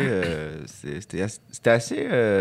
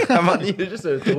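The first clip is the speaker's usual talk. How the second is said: laughing; 7 semitones higher, faster than usual. Real, not acted. Laughs at cheek joke.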